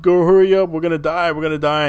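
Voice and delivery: high, loud mock wail that eases off; hardcore crying, imitated. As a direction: act out a panicked, wailing plea. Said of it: "like, hardcore crying".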